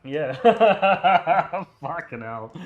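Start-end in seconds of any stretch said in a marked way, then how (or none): 0.4-1.8 laughing. Real, not acted. Laughing after inhaling bug.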